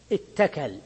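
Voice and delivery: اتكل as ittakal, short i and doubled t; merged ta. Shown as a demonstration. Teacher tests a disallowed change, اتكل with doubled ta. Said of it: "وادغمناها في الد".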